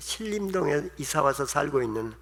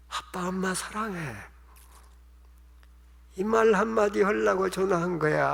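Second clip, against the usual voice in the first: tearful apology; 2 semitones higher than usual. Retold or imitated. Daughter apologizing to her father on the phone, mildly tearful. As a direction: gently imitate a daughter crying on the phone. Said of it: "이 딸이 울면서는 얘기가".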